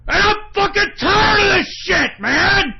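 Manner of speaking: high, loud fed-up scream; real furious yelling; pissed-off shout. Real, not acted. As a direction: scream that you've had enough. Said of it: "I'm so fucking pissed off".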